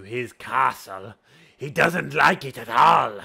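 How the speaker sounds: slow, low menace building to a roar; Graxus voice. Shown as a demonstration. Game master demos castle-dweller who hates adventurers, slow then shouting. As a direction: start slow and low, end in a loud outburst. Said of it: "the voices for all the characters".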